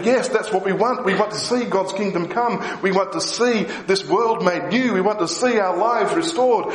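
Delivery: high, excited and eager; high eager quoting of fired-up people; eager crowd. Retold or imitated. People's hearts fire up, quoted.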